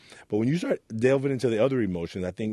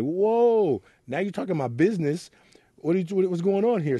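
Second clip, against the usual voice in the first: startled shock; 9 semitones higher than usual. Retold or imitated. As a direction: recoil as if she hit a nerve. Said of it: "And I was like".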